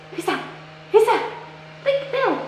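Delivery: high excited puppy talk. Real, not acted. Showing puppy the mirror.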